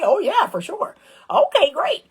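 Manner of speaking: high, eager quick agreement; eager yes. Retold or imitated. Eager reply then chirpy answer.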